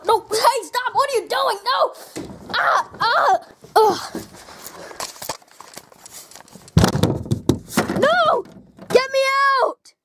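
high, frantic protests and cries; panicked yelling. Acted. Kid shut in the closet pleading, frantic and high. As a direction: scream to be let out.

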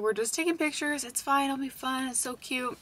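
put-on calm nervous little girls gently; reassuring kids. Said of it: "I was like".

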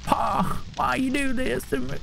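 whiny, high hillbilly plea; Appalachian accent. Pretend. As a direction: complain like a backwoods son. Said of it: "impression of a hellbilly".

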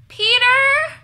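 high, loud shout of a name; long, high-pitched holler. A narrator's reading. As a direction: shout to someone elsewhere in the house. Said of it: "she shouted".

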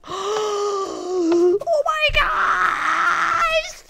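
huge gasp into a high, drawn-out scream; very loud, stretched-out squeal; amazed scream. Real, not acted. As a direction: shriek in thrilled disbelief. Amazed by a find in game.

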